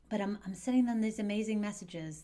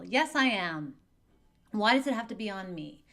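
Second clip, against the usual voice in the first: put-on loud, higher complaining teen; upset teen; 3 semitones higher, 8 dB louder than usual. Teen complaining to parent about the divorce, loud. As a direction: push back hard, still angry.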